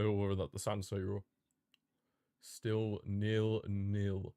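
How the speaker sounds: normal talking voice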